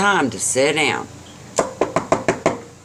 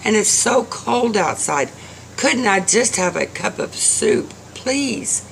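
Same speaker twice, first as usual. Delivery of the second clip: put-on high-pitched child's plea; beg, with a touch of crying; tearful pleading; 9 semitones higher than usual. A narrator's reading. Little boy begs old man for soup, tearful. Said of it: "the little boy begins to cry".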